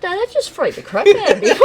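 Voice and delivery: high laughing disbelief. Real, not acted. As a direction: laugh it out, spooked. Friend reacts to creepy singing.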